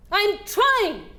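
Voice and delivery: high, loud snapping yell; yelled back. Retold or imitated. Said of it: "And I yelled back".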